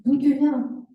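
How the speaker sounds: normal talking voice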